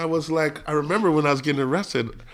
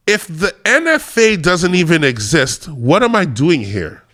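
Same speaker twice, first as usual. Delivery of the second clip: slow, deliberate courtroom pronouncement; measured, emphatic mic-drop line; mic-drop moment; slower than usual. Retold or imitated. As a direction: spell it out slowly like a closing argument. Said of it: "You dropped the mic".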